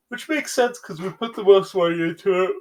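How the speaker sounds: high, loud, yawn-stretched words; real yawn taking over the speech; talking through a yawn. Real, not acted. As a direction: push the sentence out while yawning. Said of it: "I'm gonna yawn".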